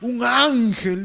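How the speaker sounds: drawn-out, mock lovestruck awe; slow, hammed-up swoon; smitten wife. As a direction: imitate a wife marvelling at her man. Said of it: "La esposa lo ve a su esposo".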